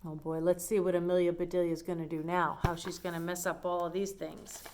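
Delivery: ordinary delivery, nothing marked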